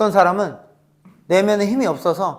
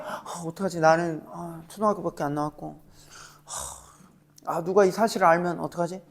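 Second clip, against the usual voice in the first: softer, fretful worried mumble; anxious fretting; 7 dB quieter than usual. Retold or imitated. Alexei panicking that people will find out, soft and fretful. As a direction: worry under the breath. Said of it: "이러다가".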